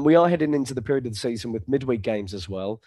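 ordinary delivery

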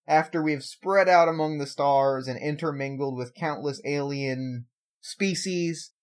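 ordinary delivery

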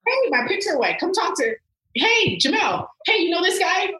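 high, urgent call for a friend's help; acted nervous plea. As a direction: plead in a high, flustered voice, hammed up. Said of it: "I get real nervous".

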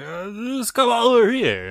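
high, put-on dopey beckoning; hammed-up clueless character voice; mock naive invitation. Mocking Billy turning his back, high naive voice. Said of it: "He's like".

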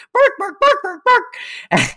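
squeaky high imitation of a dog barking. Friend imitates the dog heard through the door, squeaky barking. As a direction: yap like a small dog. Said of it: "you hear, like".

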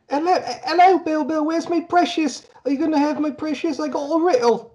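very high, squeaky falsetto voice; Terry Jones. Acted. Host spoofing a riddle creature after its precious, squeaky falsetto. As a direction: screech it sing-song in a silly falsetto. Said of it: "Shout out to the late Terry Jones there".

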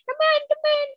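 a high, teasing lure; mock luring witch. Mimicking him calling the women, high and mocking. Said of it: "that was, like, how I felt this whole situation".

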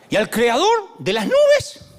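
preached slowly, voice soaring. Acted. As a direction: lift it like a revelation. Dramatic preaching build.

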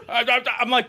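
ordinary delivery

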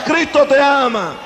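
"Cristo te ama" proclaimed high with a falling close; preached refrain. Acted. Preacher proclaiming to the congregation, ringing. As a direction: call it out to the crowd.

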